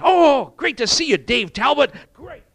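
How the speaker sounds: a loud, high, beaming hello; hearty greeting. Retold or imitated. Brother greeting Dave Talbot, hearty and loud. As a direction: greet a dear brother with delight. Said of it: "greeting another brother in the Lord says".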